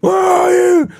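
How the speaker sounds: roaring, high-pitched shout; screaming intruder. Retold or imitated. Huge man hunting a girl through the house, screaming. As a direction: bellow it out, stretched and loud. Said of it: "he's just screaming".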